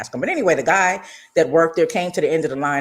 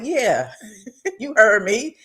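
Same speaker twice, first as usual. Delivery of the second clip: sassy defiance; 6 semitones higher, slower than usual. Retold or imitated. Hands on hip, retold defiance.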